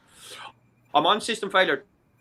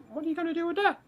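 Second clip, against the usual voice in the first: pitched way up, puzzled cellmates; squeaky voice for the two cellmates asking; high mock squeak; 14 semitones higher, 5 dB quieter, faster than usual. Cellmates baffled by the marker, high squeaky voice. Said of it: "the Englishman and Scotsman says".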